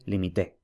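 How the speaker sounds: limité with the stress falling on the last syllable, té; stress shown. Shown as a demonstration. Teacher contrasting stress positions, stressing final té. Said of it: "como lo que he dicho antes".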